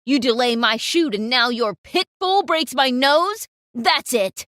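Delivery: roaring, furious tirade at the model; explosive, loud yelling rage; fuming photographer. Acted. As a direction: scream insults like a boss gone wild. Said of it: "he was fuming now".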